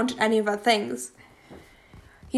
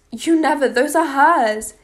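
higher, louder upset cry; distraught, raised-voice accusation; anguished outburst; 4 semitones higher, 6 dB louder than usual. A narrator's reading. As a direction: cry out in furious grief. Hysterical phone quarrel with father.

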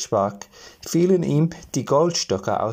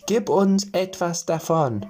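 lightly raised little gnome voice; little men voice; 6 semitones higher than usual. A narrator's reading. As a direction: ask sweetly like tiny forest men. Gnomes ask for bread.

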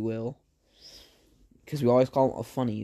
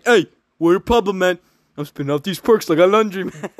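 mock customer voice; 4 semitones higher, 6 dB louder than usual. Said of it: "I'm just gonna say".

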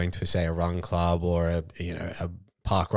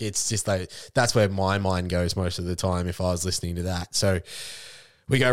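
ordinary delivery

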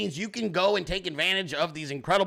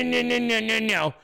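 ordinary talk, nothing marked

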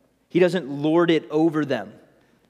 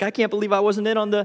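salty Peter; 6 semitones higher, 3 dB louder, faster than usual. Retold or imitated. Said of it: "He's like".

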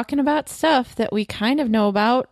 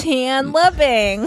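slow, louder nasal drawl; Janice voice; 5 semitones higher, 5 dB louder, much slower than usual. Retold or imitated. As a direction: stretch it out like a sitcom girlfriend. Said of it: "Like Janice from Friends".

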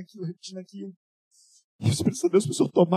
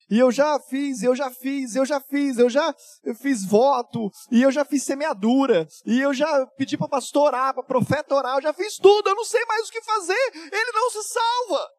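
imitating a high, rising plea that climbs much higher on tudo; desperate pleading; 8 semitones higher, 6 dB louder than usual. Church friend begging the pastor for her husband, imitated desperate pleading. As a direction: voice her mounting despair, higher and higher.

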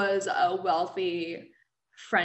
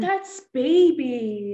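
put-on cooing baby talk; 4 semitones higher, 6 dB louder, much slower than usual. Gushing over puppy photos.

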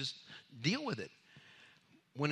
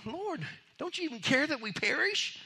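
fearful plea; 12 semitones higher than usual. Retold or imitated. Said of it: "they said that with fear".

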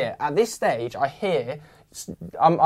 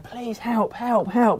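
pleading for help; 7 semitones higher, much slower than usual. Retold or imitated. Flagging down a car on the road.

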